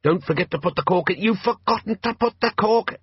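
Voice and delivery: high voice bubbling up from under water. A narrator's reading. A sinking thing calling up through bubbles, gargled. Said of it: "erupting on the surface in a cloud of bubbles".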